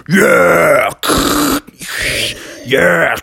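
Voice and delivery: cartoon engine sound effect; revving truck noise. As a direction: rev and skid like a big truck. Imitates T-Rex driving his truck.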